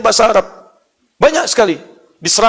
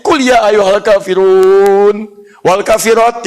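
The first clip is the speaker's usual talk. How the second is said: slow, loud, long-held vowels; mock Quranic recitation; 3 semitones higher, 6 dB louder, much slower than usual. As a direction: pretend recitation, stretched out slowly. Mock recitation with a false word. (pura-pura mengaji, lantang dan panjang)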